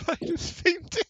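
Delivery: squeaky high mimicry through laughter; mocking echo. GM teasingly parrots the player, squeaky and giggling. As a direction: parrot her line in a silly high squeak.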